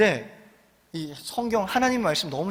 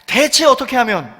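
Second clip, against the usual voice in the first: more earnest; 4 semitones higher, 7 dB louder than usual. Shown as a demonstration. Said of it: "조금만 더 간절하게".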